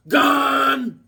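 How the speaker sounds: loud, high, drawn-out holler; calling out. Pretend. Crying out a villain's name, long mock holler. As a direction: holler one long word.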